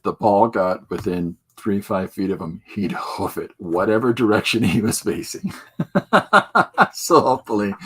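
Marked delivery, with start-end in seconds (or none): 5.6-7.7 chuckling through the words; laughing. Real, not acted. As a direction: laugh while you say it. Laughing about son's soccer.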